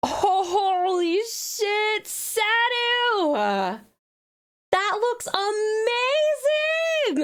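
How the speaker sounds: gasp, then gush slowly over the art; stunned delight, stretched words; excited gasp and praise. Real, not acted. First sees fan art.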